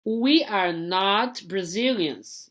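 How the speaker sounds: slow, careful English example; teacher modelling a negative; slow model sentence. Shown as a demonstration. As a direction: read each word slowly for learners. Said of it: "botando not depois".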